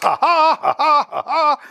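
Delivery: high, loud scornful "ha ha ha"; mocking laughter. Father and uncle jeer at the other guest with loud laughter. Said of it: "Hohngelächter".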